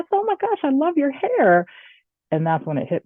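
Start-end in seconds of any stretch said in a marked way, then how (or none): 0.1-1.7 high-pitched delighted gush; gushing compliment. Retold or imitated. Telling a new hire she loves her curly hair, gushing. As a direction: gush over someone's hair, pitched right up.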